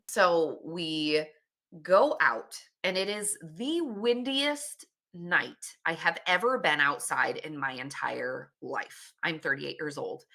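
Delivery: ordinary talk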